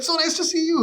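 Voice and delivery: squeaky-high mock politeness; awkward echo. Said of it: "all these awkward run-ins".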